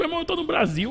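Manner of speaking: high, incredulous laughing protest; can't believe nobody understands; exasperated disbelief. Retold or imitated. Traveller marvelling that the locals can't understand him, incredulous.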